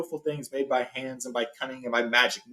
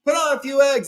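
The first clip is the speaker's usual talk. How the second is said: high, louder Gandalf calling after him; 12 semitones higher, 6 dB louder than usual. Retold or imitated. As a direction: call it down the passage. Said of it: "Gandalf called after him".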